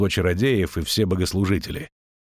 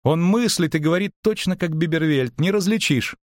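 high-pitched, delighted marvelling; admiring wonder; 8 semitones higher than usual. A narrator's reading. Bard praises the perfect copy, full of wonder. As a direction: gush in a raised voice.

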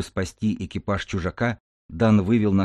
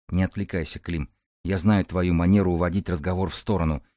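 plain reading voice

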